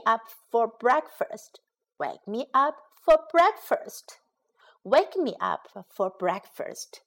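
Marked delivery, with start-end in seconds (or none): none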